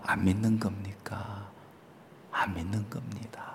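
soft unvoiced aside, just breath; whisper. Real, not acted. Preacher whispering a wry aside to the congregation. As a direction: lean in and whisper the answer.

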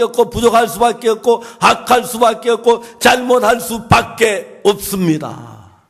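loud, rising sermon cadence; full-force preaching. Acted. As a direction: preach it out to the whole congregation. (힘차게 외치는 설교)

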